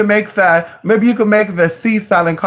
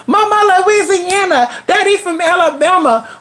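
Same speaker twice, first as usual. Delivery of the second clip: excited announcement; 11 semitones higher than usual. Real, not acted. Proudly announcing her family roots.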